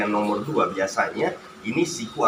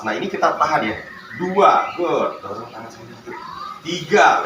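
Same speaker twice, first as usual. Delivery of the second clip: counting workout reps; 4 semitones higher, slower than usual. Real, not acted. Therapist coaching rep count.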